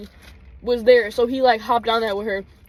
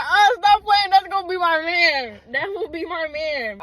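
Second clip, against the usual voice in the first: excited gushing; 7 semitones higher, slower than usual. Retold or imitated. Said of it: "I was like".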